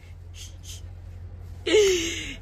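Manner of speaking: breathless high-pitched laugh; high laughing fit. Real, not acted. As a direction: crack up helplessly. Laughing fit mid-story.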